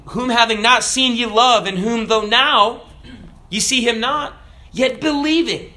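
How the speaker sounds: high, ringing scripture recitation; lifted, stately quoting of the Bible. Acted.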